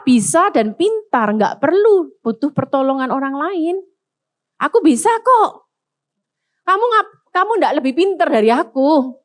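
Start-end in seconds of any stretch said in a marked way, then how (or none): 4.6-9.2 a hint of smug bragging, higher; lightly mock the proud man; arrogant person. Preacher mimicking a proud man to the congregation, mildly smug. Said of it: "Orang sombong itu".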